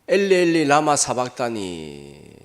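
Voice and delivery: Jesus crying out from the cross; anguished cry. Retold or imitated. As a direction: cry out to God, drawn out. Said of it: "고함을 치실 정도로".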